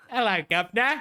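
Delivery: high, loud mock-Cockney greeting; hammed-up British street accent. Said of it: "like, a British accent".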